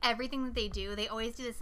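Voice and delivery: ordinary delivery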